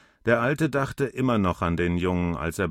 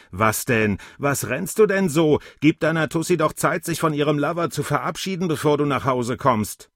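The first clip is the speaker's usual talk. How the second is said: mocking taunt; 6 semitones higher, 3 dB louder than usual. A narrator's reading. Alter verhöhnt den Jungen lautstark.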